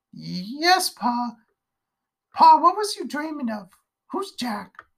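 put-on high, eager child's voice; a daughter timidly asking about the dream; curious little girl.

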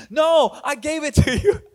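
high-pitched comic retort with a laugh; mock insistent. Pastor replaying the quarrel with his wife, mock insistent. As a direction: argue back playfully, pitch jumping up.

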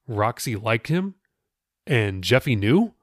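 ordinary delivery, nothing marked

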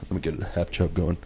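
mock sheriff. Voicing the sheriff.

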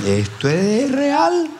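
mock panic as the demon speaks, squeaked "esto es real"; mock terrified squeak. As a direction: play the scared pastor for laughs, voice jumping way up. Pastor retelling his own fright.

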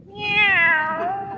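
high mock cat meow. Teacher meowing while calling the landlady to her lap.

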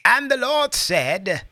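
high, slow booming preacher. Pretend. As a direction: proclaim it like a pulpit preacher. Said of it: "you gotta use your preacher voice".

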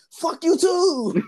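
high, slow, drawn-out comeback; mock retort. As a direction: stretch each word high and slow. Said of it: "and he was like".

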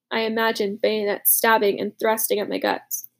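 plain reading voice

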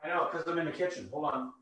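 distant voice, far from the mic; calling out from the kitchen; from another room. Real, not acted. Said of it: "I can barely hear you".

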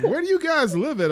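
high, loud disbelief; where on earth, teasingly; incredulous. Real, not acted.

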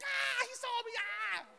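thrilled he noticed; starstruck squeal. Retold or imitated. Fan noticed by his hero, giddy and high. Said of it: "I was like".

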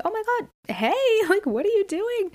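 high, bright surprised hello with a laugh; friend's excited greeting. Retold or imitated. Friend Ivy spotting her on a walk, high excited greeting.

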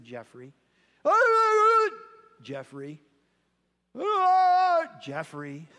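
put-on gently calm a crying child; quiet, calm. Teacher soothing a crying boy at his desk, very soft and calm. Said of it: "she just kept quietly and calmly saying it".

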